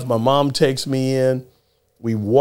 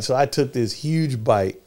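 ordinary talk

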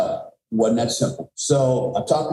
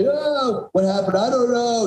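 imitating a frantic daughter; 9 semitones higher, slower than usual. Daughter answering the police after the crash, higher mock panic. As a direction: echo her frightened answers to the officer. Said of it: "she's screaming".

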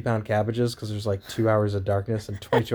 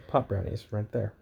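usual speaking voice

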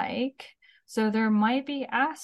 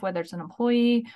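normal talking voice

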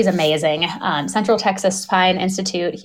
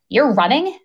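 higher-pitched retold disbelief; incredulous question; 6 semitones higher than usual. Surgeon hearing his patient still runs, incredulous question. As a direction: question it as if you can't believe it.

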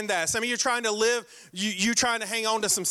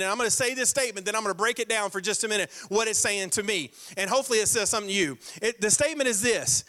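ordinary delivery

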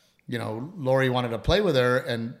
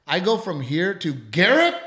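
ordinary delivery

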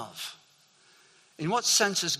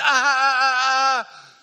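put-on high, loud toddler's "ah, ah" as she reaches up; loud, high baby-like calling out; imitated baby cries; 9 semitones higher, 11 dB louder, slower than usual. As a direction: mimic a baby begging to be picked up. Granddaughter reaching out, can't speak.

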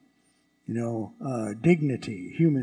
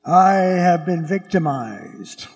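slow, loud aggrieved protest; a hint of a whining victim pleading his case; complaining victim; 4 semitones higher, 6 dB louder, slower than usual. Citizen protesting to a Roman emperor, slow and loud. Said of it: "and say to the Roman emperor".

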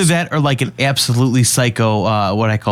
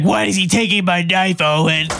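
higher put-on holler; yelling woman; 6 semitones higher than usual. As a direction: imitate her indignant mock yell. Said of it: "And she's like".

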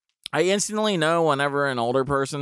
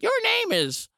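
put-on high, questioning old lady; confused old neighbor; 5 semitones higher, 6 dB louder than usual. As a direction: squeak up on the name. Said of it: "she's like".

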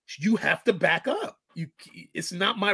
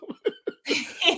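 high laugh; 3 dB quieter than usual. Real, not acted.

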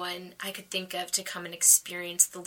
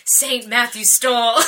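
laughing; 3 semitones higher, 10 dB louder than usual. Real, not acted. Quoting her diary, laughing.

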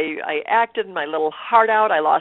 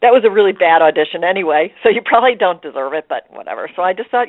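ordinary delivery

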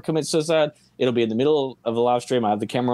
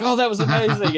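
mock amazed; 9 semitones higher than usual. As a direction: gasp it like a cartoon duck. Voicing cartoon gasp quote.

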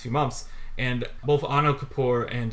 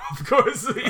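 higher, amused reply through laughter; laughing; 10 semitones higher than usual. Real, not acted. Host confirming a Chicago landmark to co-host, laughing. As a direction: repeat it while cracking up. Amused repeat of own answer.

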